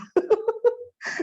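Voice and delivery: high bright laugh; burst of laughter. Real, not acted. Teacher laughing at her own siblings' joke. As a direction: laugh at the family story.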